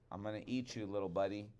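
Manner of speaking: ordinary delivery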